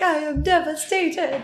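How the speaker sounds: high, hammed-up heartbroken line; mock devastated. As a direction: play it like a melodramatic wreck. Dramatic breakup reaction quoted.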